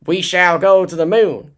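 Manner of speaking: attempted Massachusetts accent, landing nearer Ross Perot; Kennedy impression. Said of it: "a Massachusetts accent like Kennedy".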